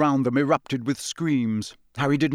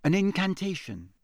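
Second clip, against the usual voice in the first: higher-pitched, nervous account; shaky; 5 semitones higher than usual. A narrator's reading. Hermione telling the wizards about the voice, shakily. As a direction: explain haltingly and fearfully. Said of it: "said Hermione shakily".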